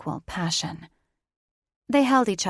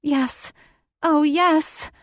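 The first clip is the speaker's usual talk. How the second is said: high, rising ecstatic yes; breathless cries; 8 semitones higher, 7 dB louder, much slower than usual. Acted. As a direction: cry out in pleasure. Heroine climaxing, rising cries.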